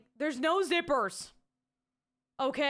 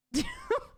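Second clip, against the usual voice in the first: short high laugh; sudden high burst of laughter. Real, not acted. Embarrassed at own line, short high laugh.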